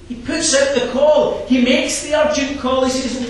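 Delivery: loud, high, rousing preacher's voice; full-force preaching. Acted. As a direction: drive the verses home from the pulpit.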